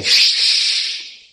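a sharp whispered shh; whispered hush. Acted. Angel silencing Gabriel, whispered shh. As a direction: hush him quickly.

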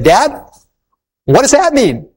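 put-on high childlike questioning voice; small daughter puzzling over a painting; little girl. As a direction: wonder aloud like a small girl.

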